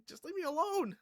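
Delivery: high, loud mock exasperation; imitating a fed-up girl's outburst; frustrated. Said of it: "She's getting frustrated".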